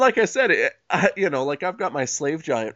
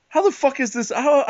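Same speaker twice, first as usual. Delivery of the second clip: much higher, cracking into a laugh; incredulous outburst; 10 semitones higher than usual. Real, not acted. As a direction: disbelief spilling over. Exasperated outburst at absurd argument.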